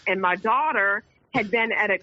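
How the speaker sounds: usual speaking voice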